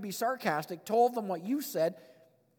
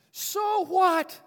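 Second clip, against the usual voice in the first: high, loud, triumphant shout; gleeful defiance; 12 semitones higher, 8 dB louder than usual. Acted. Preacher relishing his favourite part, gleefully defiant.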